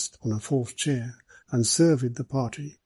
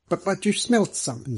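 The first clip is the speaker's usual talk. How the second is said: reproachful; 7 semitones higher than usual. A narrator's reading. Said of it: "said Mister Middleton reproachfully".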